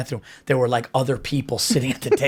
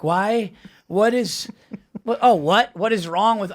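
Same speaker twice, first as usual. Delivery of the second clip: higher-pitched mock wounded protest; playing the victim; 5 semitones higher, slower than usual. Retelling the date's "what is wrong" act, mock victim. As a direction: lightly mimic someone acting the victim. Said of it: "she did the whole, like, victim".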